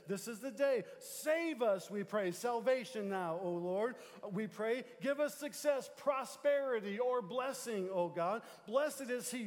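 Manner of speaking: loud, slowing, preached psalm; fervent preaching. Acted. Pastor leading the church in the psalm, fervent preaching. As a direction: preach it out, stretching the last lines.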